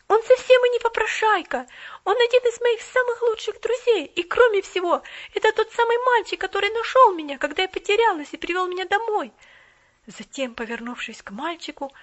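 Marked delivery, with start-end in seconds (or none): 0.1-9.3 high, loud eager defense; girl defending boy, high and loud; girl defending a friend. Acted. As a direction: stand up for him hotly. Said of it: "встала на защиту".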